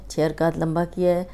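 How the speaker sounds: ordinary delivery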